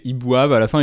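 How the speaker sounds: usual speaking voice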